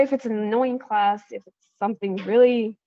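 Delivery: normal talking voice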